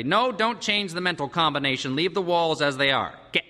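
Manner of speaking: commanding father. A narrator's reading. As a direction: order them out, brooking no delay. Father orders children out.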